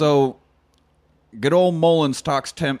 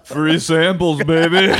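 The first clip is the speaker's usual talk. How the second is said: loud, louder-building gag punchline; playful holler; 4 semitones higher, 6 dB louder, slower than usual. Pretend. Shouted donut-conveyor gag punchline.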